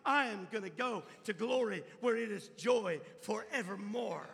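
loud, high preaching at full force, slowing down. Acted. Preacher telling the congregation about eternal joy, full-force preaching. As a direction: proclaim it like a revival preacher.

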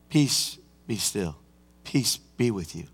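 soft, slow, hushed calm; gentle peace. Acted. Jesus calming his disciples, soft and low. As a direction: speak peace over frightened men.

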